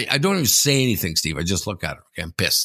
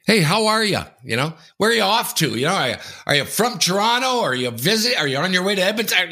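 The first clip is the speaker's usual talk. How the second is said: high chirpy small-talk voice; mock chatty passenger; 7 semitones higher than usual. Mimicking the chatty seatmate, high and friendly. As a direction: play the nosy friendly neighbour. Imitating chatty seat neighbour.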